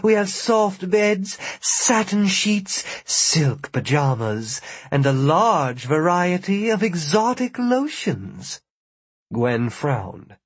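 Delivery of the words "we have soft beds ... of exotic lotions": high, slow coy purr; coy blonde voice. Acted. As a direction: lay on the coy charm. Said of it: "the blonde said".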